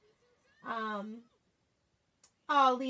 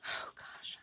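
hushed whisper of suspense; whispered oh gosh; 13 dB quieter than usual. Real, not acted. Crafter peeling the stencil carefully, whisper. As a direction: breathe it out as a whisper.

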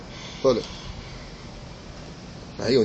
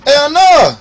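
excited; 6 semitones higher, 7 dB louder than usual. Real, not acted. Reacting to the smoke.

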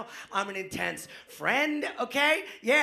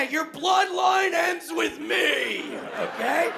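mock menacing threat; 6 semitones higher, much slower than usual. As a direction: drag it out like a movie villain. Mock threat to rude stranger.

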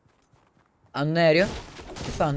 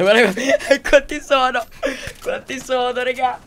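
high, loud, laughing through the words; real laughter at the sheer numbers; laughing disbelief; 8 semitones higher, 6 dB louder, slower than usual. Real, not acted. Laughing at huge enemy team. (incredulo tra le risate, voce alta e forte)

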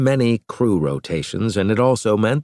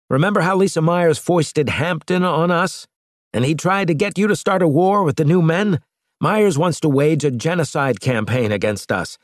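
higher, fast, insistent captain; captain pressing his case, pitched up; Maddox pleading; 7 semitones higher than usual. A narrator's reading. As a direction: urge the admiral to trust him.

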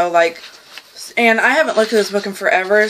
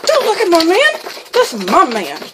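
high, loud play-acted scolding; hammed-up warning to a rival; mock possessive fury; 9 semitones higher, 4 dB louder than usual. Woman warning a neighbor staring at her man, mock-angry. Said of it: "you just be like".

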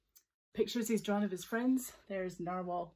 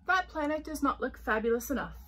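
haughty; 6 semitones higher, 5 dB louder than usual. A narrator's reading. Unicorn haughty.

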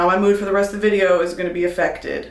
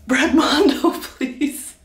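laughing plea, high; 6 semitones higher, much slower than usual. Real, not acted. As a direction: laugh out the name. Laughing at hairdresser.

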